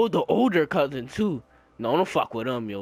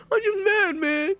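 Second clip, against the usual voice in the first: high mock-tearful whine; squeaky imitated sob-talk; imitating crying cousin; 12 semitones higher, slower than usual. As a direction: insist through pretend tears, pitched way up. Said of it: "He was crying".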